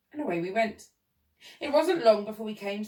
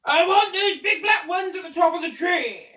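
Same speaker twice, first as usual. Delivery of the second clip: put-on high, loud demanding old man; shouting; 10 semitones higher, 10 dB louder than usual. A narrator's reading. Old man demands cherries from gardener, shouting. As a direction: shout orders up into a tree. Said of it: "the man was shouting".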